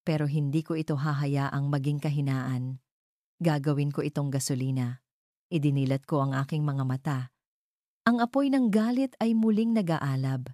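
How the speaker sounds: ordinary delivery, nothing marked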